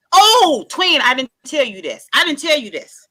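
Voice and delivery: high and loud, bursting out; excited realisation. Real, not acted. Suddenly remembering to tell friends, excited.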